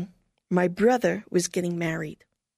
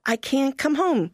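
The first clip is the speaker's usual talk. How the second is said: higher, emphatic protest; firm refusal; 8 semitones higher than usual. Retold or imitated. Missionary refusing her mother, higher and emphatic. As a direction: stand your ground, higher. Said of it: "I said".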